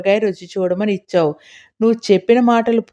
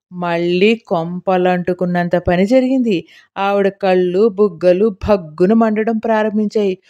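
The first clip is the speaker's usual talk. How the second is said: plain reading voice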